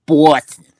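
loud Boston guy; lightly put-on male Boston accent, punched out. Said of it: "Guy's Boston accent where he's like".

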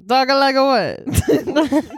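high, hammed-up Italian accent. Host teased to talk like that all episode, mock Italian accent. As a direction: play up a cartoonish Italian accent. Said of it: "You should talk like that".

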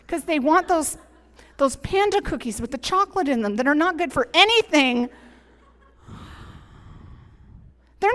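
loud, impassioned sermon voice; preaching at full force. Real, not acted. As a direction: preach it out to the whole room.

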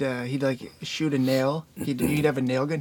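ordinary talk, nothing marked

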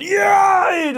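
loud, high cheer of joy; shout. Retold or imitated. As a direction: yell it out at the top of your voice. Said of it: "Jeg råbte bare sådan". (høj, jublende råben)

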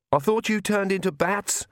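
high, understated shout; mild shout. A narrator's reading. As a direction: call it across to a companion in the air. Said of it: "she shouted to Vlad".

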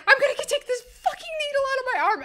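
fast, high-pitched mock panic; imitated frantic outrage. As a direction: rush it out high, half laughing. Acts patient's frantic threat.

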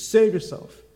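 high, sneering save yourself; mocking taunt. Soldiers jeering at Jesus on the cross, high mocking taunt. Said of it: "The soldiers also came up and mocked him".

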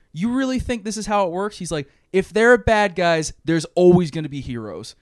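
rising, fired-up retelling of a hero's defiant speech; mock-heroic, loud and passionate. Damian telling the villains heroes will always rise, fired up.

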